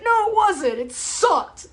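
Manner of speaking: a scoffing no, then a flat it sucked; retold retort. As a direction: fire it back, loud and high. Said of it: "I'm like".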